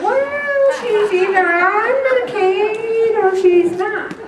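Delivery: high, sharp, exasperated retort. Retold or imitated. Retorting to the evasive caller, pitched high and exasperated. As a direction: lay it out with pointed impatience.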